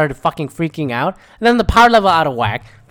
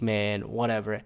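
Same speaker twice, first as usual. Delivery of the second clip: low, much softer shrug-off; dismissive resignation; 6 semitones lower, 11 dB quieter than usual. Retold or imitated. Retelling giving up on the confusing battle, dismissive resignation. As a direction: drop it quietly, giving up. Voices own giving up on plot.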